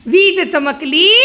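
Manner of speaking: वीज चमकली slow and loud, rising at the end; drawn-out prompt. Shown as a demonstration. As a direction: stretch वीज चमकली to cue the child. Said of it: "prompting the answer". (ताणून विचारलेला प्रश्न)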